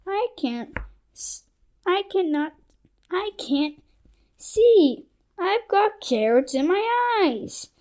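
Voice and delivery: put-on high, upset girl voice; screaming girl. A narrator's reading. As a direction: cry it out in a high panicked voice. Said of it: "Andrea screamed".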